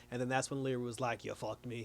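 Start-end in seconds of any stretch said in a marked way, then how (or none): 1.2-1.8 low, much softer retold accusation; a hushed, lowered imitation of a betrayed man; quiet accusation. Leary tells the boss he was betrayed, low and hushed.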